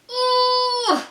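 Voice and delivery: high, loud strained cry of effort; a sudden strained ooh as he heaves; effort grunt. A narrator's reading. Beam straining at the heavy leg, high effortful cry.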